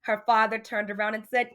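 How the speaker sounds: ordinary delivery, nothing marked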